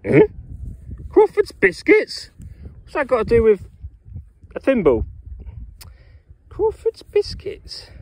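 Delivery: high-pitched, puzzled disbelief; real bafflement. Real, not acted. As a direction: question it in a high, incredulous voice. Baffled by writing on the thimble.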